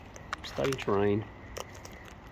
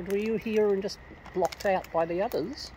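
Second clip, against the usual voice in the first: usual speaking voice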